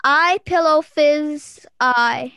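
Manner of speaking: I pillow fizz eye read slowly word by word; drawn-out puzzle read; slow sounding out. Shown as a demonstration. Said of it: "I don't say that one".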